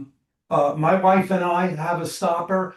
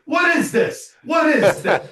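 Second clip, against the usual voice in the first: much higher, louder mock outrage; hammed-up cry; 10 semitones higher, 6 dB louder than usual. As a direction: yell it with a naked grin. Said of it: "he's going".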